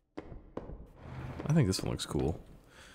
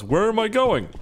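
surprised; 11 semitones higher, 3 dB louder than usual. Real, not acted. Sudden lost, voice jumps.

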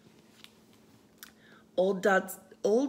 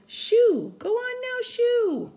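high, loud shoo to chase him off; shooing away; 6 dB louder, slower than usual. Acted. Lady with pans shooing the wet dog, high and loud.

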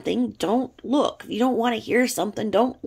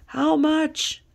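high, loud calling out from a passing car; men shouting from cars; 7 semitones higher, 4 dB louder, much slower than usual. Retold or imitated. Men in cars yelling at her, loud catcalling. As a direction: holler it like a catcaller leaning out the window. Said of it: "and they were like".

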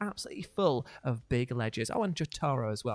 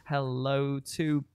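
ordinary talk, nothing marked

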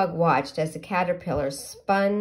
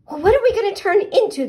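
much higher, louder and fast; eager pollywog; 14 semitones higher, 10 dB louder, much faster than usual. Acted. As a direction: tumble out the question in excitement.

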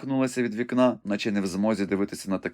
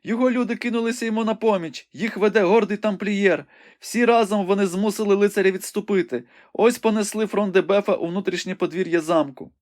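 high, urgent maiden reporting a battle; young woman Rebecca, breathless and excited; 11 semitones higher than usual. A narrator's reading. Rebecca calls the battle out to the wounded Ivanhoe, high and urgent. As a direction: describe the fight in a high, eager voice.

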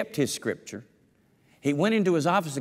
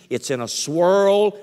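loud slow emphasis; 6 semitones higher, 8 dB louder, slower than usual. Acted. Preacher building to a peak.

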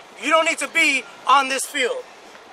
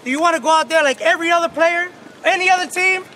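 pep speech; 2 semitones higher than usual. Real, not acted. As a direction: fire them up. Coach firing up team.

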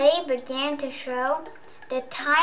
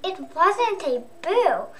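ordinary delivery

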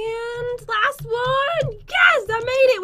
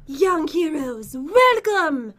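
princess voice; 7 semitones lower, much slower than usual. Pretend. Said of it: "can you do the princess voice?".

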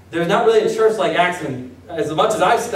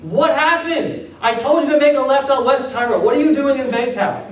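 mock exasperated phone call; exasperated caller; 6 semitones higher than usual. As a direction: play the frustrated friend on the phone. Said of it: "That kind of conversation".

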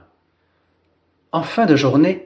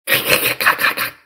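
voiceless, crackling rhythmic incantation; whispery clicking chant; magic spell. A narrator's reading. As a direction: rattle off the spell in crisp, toneless bursts. Fairies' magic spell in story.

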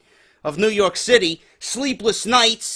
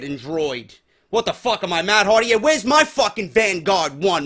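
ordinary talk, nothing marked

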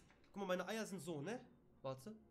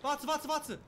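high, loud frantic yelling throughout; frantic shouting; 8 semitones higher, 9 dB louder than usual. Real, not acted. Gamer to the monster, frantic loud yelling. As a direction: shout urgently in real panic.

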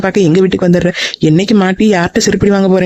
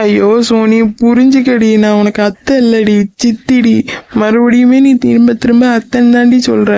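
higher, whiny fake sob; mock crying; 3 semitones higher, slower than usual. Aunt fake-cries so niece calls her chitthi. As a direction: play-act weeping to guilt her. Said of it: "அழுகாதீங்க please".

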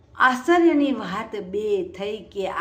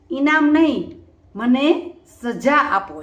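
emphatic title; 4 semitones higher, 7 dB louder, slower than usual. Shown as a demonstration. Quoting the title with force.

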